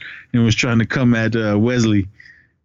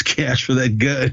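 ordinary delivery

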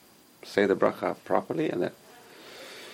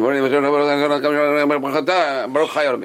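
gabbled, mumbled blessing rising into a drone; rushed blessing; 4 semitones higher, 4 dB louder than usual. Acted. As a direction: race through it to get it over with. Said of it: "saying the whole bracha fast".

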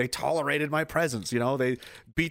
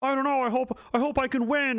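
much higher, meek mock worry; mock timid hope; 10 semitones higher than usual. Mocking a frightened fighter, meek and unsure. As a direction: play the scared soldier hoping to win.

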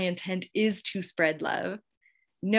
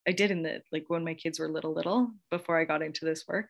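usual speaking voice

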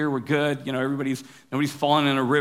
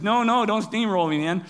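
higher mock Canadian protest; lightly put-on Canadian accent; 6 semitones higher than usual. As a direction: imitate a kid pleading in Canadian. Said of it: "in his best Canadian accent".

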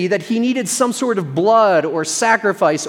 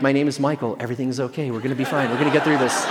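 soothing calm; 6 semitones lower, 4 dB quieter, faster than usual. Retold or imitated. Calming an enraged skier.

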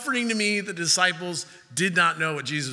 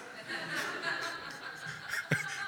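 laugh; 10 semitones higher, 12 dB quieter than usual. Real, not acted. Laughing at the disciples' confusion.

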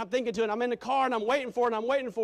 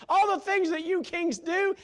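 loud, high fervent preaching; preaching at full force; 13 semitones higher, 10 dB louder than usual. Real, not acted. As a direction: preach it out with fire.

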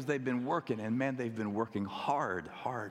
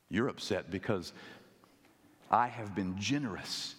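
normal talking voice

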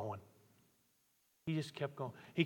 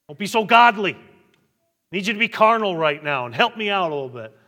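mock pleading shout; 5 semitones higher, 12 dB louder, faster than usual. Pleading for a carnal pastor.

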